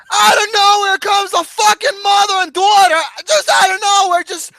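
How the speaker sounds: high, loud, incredulous outburst; worked-up yelling rant; exasperated comic shouting. Real, not acted. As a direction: shout it in laughing disbelief, voice shooting up. Hammed-up retelling of sudden movie entrance.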